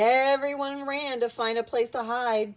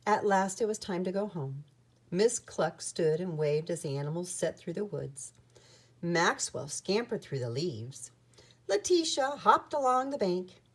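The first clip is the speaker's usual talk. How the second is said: usual speaking voice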